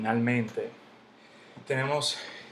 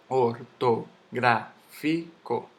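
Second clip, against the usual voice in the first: ortográfico very slowly as or-to-grá-fi-co; syllable by syllable; 3 dB louder, much slower than usual. Shown as a demonstration. Teacher sounding out the word for students, very slow separate syllables. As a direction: pull ortográfico apart syllable by syllable. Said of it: "yo digo ortográfico".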